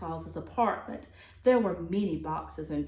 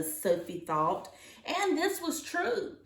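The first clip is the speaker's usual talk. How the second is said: ordinary delivery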